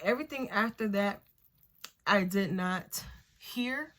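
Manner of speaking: ordinary talk, nothing marked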